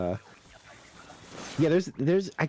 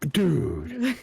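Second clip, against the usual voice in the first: mock shock; 8 semitones higher than usual. Imitating shocked viewers.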